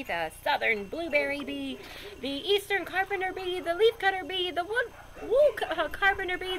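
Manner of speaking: high, loud, eager listing of bees; gushing enthusiasm. Acted. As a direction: rave to a reluctant friend. Narrator's own quoted enthusiastic pitch.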